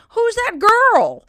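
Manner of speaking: high, loud, slow mock of a confused relative; puzzled relative. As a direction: ham up an old relative squinting at the kid. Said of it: "relatives who'll be like".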